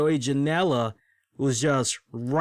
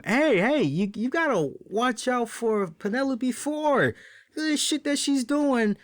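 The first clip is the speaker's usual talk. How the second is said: higher, slowed voiced warning to the ref; Arn Anderson warning; 9 semitones higher than usual. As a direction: lean in and caution him.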